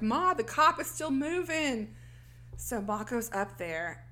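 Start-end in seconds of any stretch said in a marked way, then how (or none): 0.0-1.9 higher, slower stretched-out call; mild retold yell from upstairs; mock scream. Jeannie warns Ma from upstairs, mock scream. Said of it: "Jeannie screams from upstairs".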